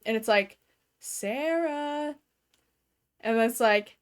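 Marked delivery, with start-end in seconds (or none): 1.0-2.1 creepy mimic calling. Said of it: "this thing that's mimicking her mom".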